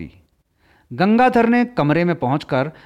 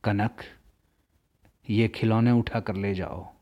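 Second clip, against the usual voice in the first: choked voice; 5 semitones lower, 6 dB quieter than usual. A narrator's reading. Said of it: "भर्राई हुई आवाज में कहा".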